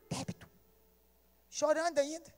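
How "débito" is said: a barely audible, tearful "Débito"; too choked up to speak; choked near-silent word. Retold or imitated. Crying, unable to speak. ("Débito" quase sem voz, chorando)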